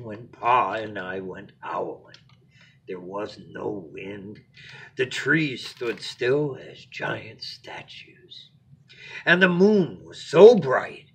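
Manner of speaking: lively storybook narration; expressive read-aloud to a little one; bedtime story reading. A narrator's reading.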